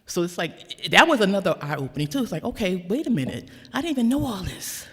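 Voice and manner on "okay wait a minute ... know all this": surprised realization. Retold or imitated. Retold surprise at family history.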